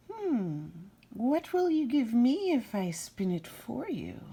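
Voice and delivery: high bargaining imp voice; little man. Acted. Little man bargaining with the girl, high and sly. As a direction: pitch it up and wheedle. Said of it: "asked the mannequin".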